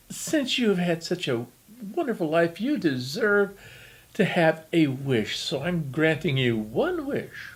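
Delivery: high, sweet fairy godmother voice. Acted. Devil disguised as fairy godmother tempts the old man, high sweet voice. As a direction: devil sweetly offering a wish. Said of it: "in his best fairy godmother voice".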